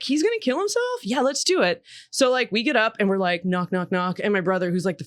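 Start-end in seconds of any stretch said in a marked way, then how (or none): 0.0-1.8 put-on high, sarcastically eager kid voice; a hammed-up gleeful excuse; mock eager. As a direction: pretend thrilled to have an excuse to wake mom.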